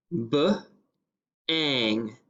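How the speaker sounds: "b-ang" split, onset then rime; segmented word. Shown as a demonstration. Teacher splitting "b-ang" for the class. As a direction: tap out "b-ang" in two parts. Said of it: "then we're going to pound it".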